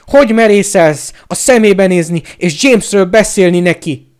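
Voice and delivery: outraged shouted challenge; furious roar. A narrator's reading.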